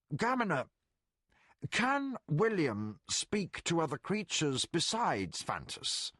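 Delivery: gentle Colgan. Acted.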